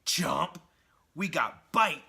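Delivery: little monsters snapping; talking bombs. Retold or imitated. Symbiote bombs taunting Spider-Man, loud impish voices. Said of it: "The actual bombs are talking themselves".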